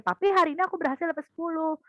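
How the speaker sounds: put-on high, fast proud kid voice; a hint of a proud child; eager child. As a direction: rush out the good news like a child. Child tells father good score.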